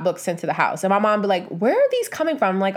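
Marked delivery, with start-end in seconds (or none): 1.5-2.6 higher-pitched imitation of mom asking; puzzled mom. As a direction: imitate a baffled mom, voice lifted high. Retold mom questioning yacht books.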